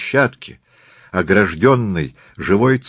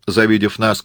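ordinary delivery

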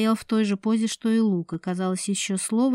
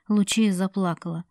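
ordinary delivery

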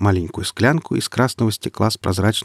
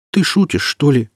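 high, louder incredulous question; surprised; 7 semitones higher, 5 dB louder than usual. A narrator's reading. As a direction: ask in amazed disbelief. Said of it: "удивился Марек".

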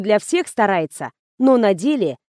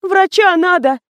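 high, loud alarmed cry; frightened high-pitched outburst; trembling voice; 9 semitones higher, 5 dB louder than usual. A narrator's reading. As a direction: call for help in panic. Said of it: "дрожащим голосом пояснила Кира".

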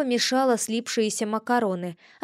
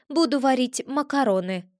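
plain reading voice

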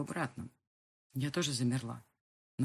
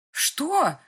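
sharp, high, loud yelp; a girl's frightened cry as the door flies open; startled cry; 12 semitones higher, 11 dB louder than usual. Acted. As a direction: cry out in sudden alarm. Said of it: "вскрикнула я".

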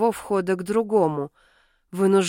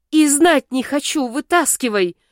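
higher, louder indignant retort; angry refusal; 6 semitones higher, 4 dB louder than usual. A narrator's reading. Heroine rejecting partner's words, angry pained retort. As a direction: cut him off in protest.

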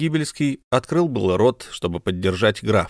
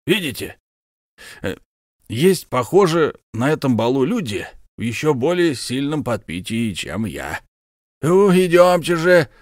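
put-on high, hearty bragging old soldier; boastful count Bekker; 5 semitones higher than usual. Count presents his comrade to his wife, high and proud. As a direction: show off your war comrade.

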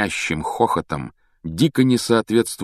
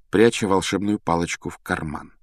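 plain reading voice

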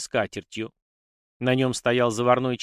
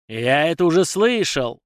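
loud, higher enraged roar; furious shout; 5 semitones higher, 6 dB louder than usual. A narrator's reading. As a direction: explode at the servant. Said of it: "ударил всей тростью по круглому". (яростный крик)

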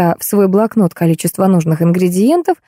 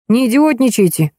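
plain reading voice

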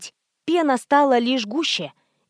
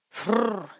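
snorting snort; 5 dB quieter than usual. A narrator's reading. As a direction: snort like a beast. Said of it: "шумом заявил незнакомец".

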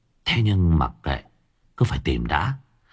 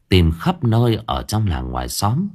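ordinary delivery, nothing marked

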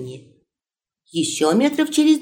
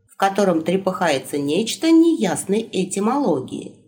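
usual speaking voice

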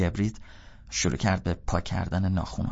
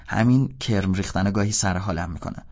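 usual speaking voice

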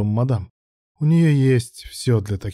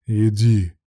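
insistent; 3 dB louder than usual. A narrator's reading. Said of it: "настойчиво произнёс Руслан".

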